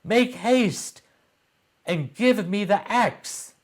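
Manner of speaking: put-on a boy shouting to his mother for the axe; urgent cry. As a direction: beg for the axe, fast. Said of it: "cried Jack".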